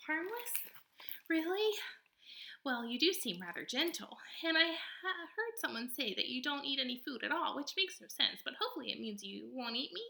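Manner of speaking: high, lively possum voice, theatrical; a showy little stage actor of a possum; possum character voice. Acted. Possum boasting to the robot, high and theatrical.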